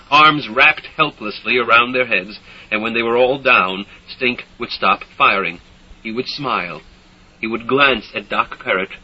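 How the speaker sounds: ordinary delivery, nothing marked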